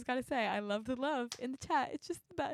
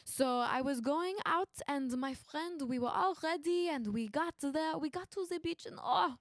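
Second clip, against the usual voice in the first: put-on playful French accent. Game player telling of a beret and the beach, French accent. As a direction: tell it like a French storyteller, accent on.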